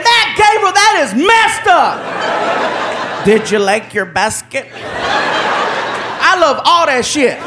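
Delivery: high, very slow laughing complaint; pranked man laughing at Gabriel; laughing protest. Retold or imitated. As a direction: drag it out while laughing.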